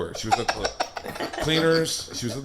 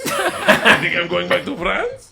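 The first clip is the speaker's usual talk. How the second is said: high mock foreign accent drifting toward French; accent slipping into French; 6 semitones higher, much slower than usual. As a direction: let the fake Russian accent collapse into French, amused. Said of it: "my accent's falling apart back to France".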